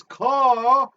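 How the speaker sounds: loud, high, drawn-out dazed voice; Cooper in a stupor. Acted. Host imitating a dazed man saying coffee, stupefied drawl. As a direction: stretch "Ka-hee" out in a hammed-up stupor. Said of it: "Cooper in a stupor saying coffee".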